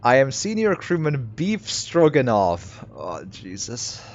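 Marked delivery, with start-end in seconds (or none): 0.0-2.8 put-on slow and proud in a Russian accent, a bit higher; Russian-accented sailor. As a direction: announce your rank in a thick Russian accent. Sailor introduces himself.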